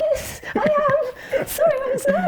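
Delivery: squeaky, giggling yes I am; high laughing apology. Retold or imitated. Answering the immigration officer, high and giggling. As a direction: sound sheepish and amused.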